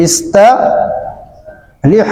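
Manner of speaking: ইস্তারিহ stretched very slowly; drawn-out demo. Shown as a demonstration. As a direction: draw out ইস্তারিহ as slow as you can. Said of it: "কত সহজ দেখছেন?".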